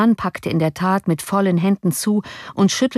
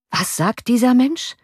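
higher, startled alarmed question; turning pale; 7 semitones higher than usual. A narrator's reading. The Highness turns pale hearing the news, startled high question. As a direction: ask in sudden dread. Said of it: "fragte erbleichend die Hoheit".

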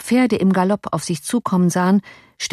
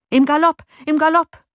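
hoch und lauter gerufen; 10 semitones higher, 4 dB louder than usual. A narrator's reading. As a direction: Im Galopp drängend hinausrufen. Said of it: "rief Johann". (Johann treibt den Kutscher an: laut, hoch)

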